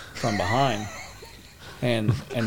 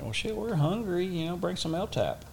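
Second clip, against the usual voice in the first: high, mock-casual joke; joking; 9 semitones higher, 3 dB quieter than usual. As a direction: toss it off cheekily, voice up high. Joking response to radio chaos.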